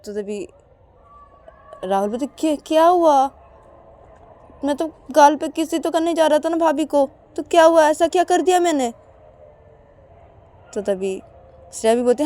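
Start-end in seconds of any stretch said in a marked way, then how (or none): 2.2-8.9 high innocent protest; protesting younger brother. Acted. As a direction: play dumb and defend yourself.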